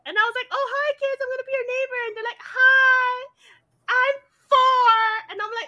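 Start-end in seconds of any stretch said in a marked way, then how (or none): 0.5-2.0 put-on high, loud hello to little kids; friendly greeting to kids. Greets neighbour kids in retelling. 2.4-5.0 put-on loud, high toddler announcement; imitated four-year-old.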